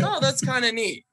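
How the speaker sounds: high, lightly voiced shrug; casually underwhelmed scientist; unimpressed. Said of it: "he's like".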